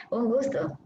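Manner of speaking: ordinary delivery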